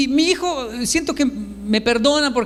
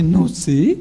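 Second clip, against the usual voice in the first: low start swooping up sheepishly; mock-sheepish "don't know"; imitated giggly answer; 4 semitones lower, 3 dB louder than usual. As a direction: play a boy laughing off the question. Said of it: "Y riéndose más dice".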